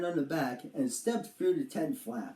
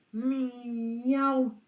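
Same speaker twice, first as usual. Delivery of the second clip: kitten meow; 6 semitones higher than usual. Acted. Kitten cries outside tent.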